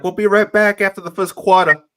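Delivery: loud broadcaster-style mock New York accent; bad New York accent. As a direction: ham up a Canadian faking a New York accent. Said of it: "a Canadian with a really bad New York accent".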